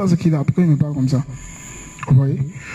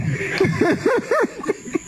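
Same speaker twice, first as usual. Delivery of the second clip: high laugh; 14 semitones higher, 6 dB quieter than usual. Real, not acted. Laughs after joke.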